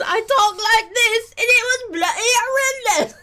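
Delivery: high, loud put-on English accent; mock English accent. Mimicking the Office character's English accent. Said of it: "what was the chick's name on The Office".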